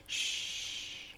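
soft whispered shush. Shown as a demonstration. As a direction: breathe a quiet shh. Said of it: "I might be a bit like".

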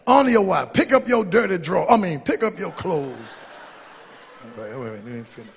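ordinary delivery

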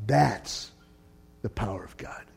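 breathy hushed whisper; whispered awe. Real, not acted. Preacher drops to a whisper.

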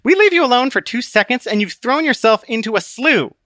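Book, scolding. Acted.

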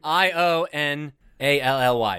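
slow letter-by-letter spelling. Shown as a demonstration. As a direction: spell each letter out slowly. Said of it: "the word is occasionally".

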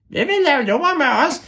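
very high, squeaky voice; high ævle bævle voice. Shown as a demonstration. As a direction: squeak like the mystery voice.

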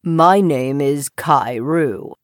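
low, slow frog voice; deep, measured frog; Kairu. A narrator's reading. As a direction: introduce yourself deep and unhurried. Frog introduces himself.

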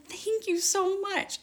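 high, slow, grateful thanks; imitating her own overjoyed thank-you; gushing thanks. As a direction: pour out retold gratitude, drawn out and high. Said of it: "So I was like".

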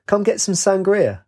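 calling out. A narrator's reading. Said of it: "shouted at them".

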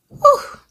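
sharp high whew of release; emotional exhale. Real, not acted. Shaken by the verse she just read, high whew. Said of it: "that sent chills down my spine".

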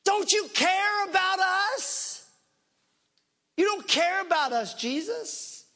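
hammed-up frantic reproach; accusing disciples. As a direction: imitate panicked men turning on their leader. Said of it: "lose their mind and start attacking him".

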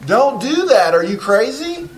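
high-pitched comic outburst; appalled scolding. Acted. Counselor reacting to client's confessed habit, appalled scolding. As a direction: cut in, aghast at the patient.

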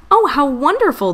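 queen thrilled by a present; delighted exclamation. Acted. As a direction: gush over the gift. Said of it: "the queen exclaimed".

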